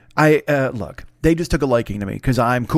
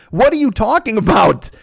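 higher-pitched, exasperated comeback; indignant retort; 7 semitones higher, 3 dB louder than usual. Retold or imitated. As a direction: throw it back in disbelief, acting out the argument. Retold argument with the producer.

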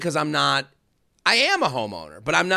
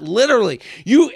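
ordinary delivery

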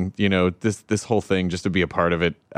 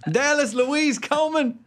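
Southern mom; 14 semitones higher than usual. Retold or imitated. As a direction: sing out her full name Southern style.

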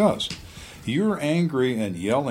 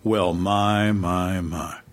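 Antichrist interrogator; 3 semitones lower, much slower than usual. Acted. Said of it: "change from Mister Nice Guy to the Antichrist".

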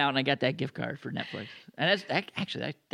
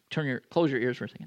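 normal talking voice

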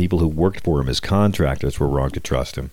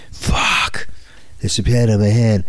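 mock-upset Trump impression, hoarsely cursing then higher; put-on exasperated Trump; 4 semitones higher, slower than usual. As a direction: imitate a president fussing over a small mishap. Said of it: "He's so upset".

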